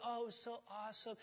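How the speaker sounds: soft, dreamy mock gush; mock nostalgia. Preacher mocking old days, soft dreamy gush. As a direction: sigh it out wistfully.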